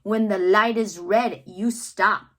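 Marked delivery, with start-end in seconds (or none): none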